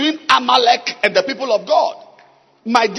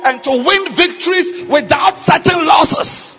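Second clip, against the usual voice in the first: raised, loud prayer declaration; full-force preaching; 4 semitones higher, 4 dB louder than usual. Real, not acted. Preacher praying for people to get victories, loud and impassioned. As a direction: proclaim the blessing over the crowd. Preacher prays over congregation.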